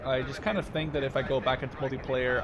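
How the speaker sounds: ordinary talk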